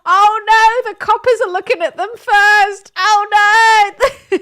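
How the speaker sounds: high, loud cries of alarm; shocked outcry. Real, not acted. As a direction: blurt it out in real shock. Shocked reaction reading fundraiser page.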